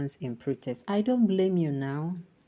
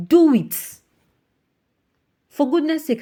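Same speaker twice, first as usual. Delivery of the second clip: much higher and louder, fed up; exasperated outburst; 9 semitones higher, 8 dB louder than usual. Real, not acted. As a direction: shout the demand. Exasperated demand to president.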